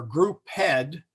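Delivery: group head, slowly split before a separate second syllable; wrongly split grouped. Shown as a demonstration. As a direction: break it as group. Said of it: "get the syllables parsed right".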